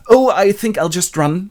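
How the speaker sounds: high, loud flustered cry; hammed-up alarm before fleeing; panicked exit. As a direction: squeal it as you bolt. Cassio flees approaching boss.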